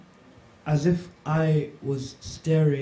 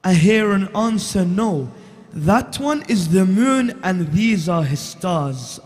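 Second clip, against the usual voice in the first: slow, lifted elegy, louder on the repeat; poem recitation; 4 semitones higher, 6 dB louder, much slower than usual. Acted. Reciter opens his Karbala poem for the gathering, mournful declamation. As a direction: declaim the lament line by line.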